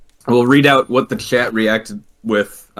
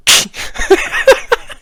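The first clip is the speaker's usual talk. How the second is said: high squealing shriek of disbelief; mock shocked scream; 4 dB louder than usual. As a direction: let out a sudden high shriek. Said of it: "while staring at my phone, went".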